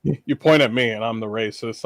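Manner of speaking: ordinary delivery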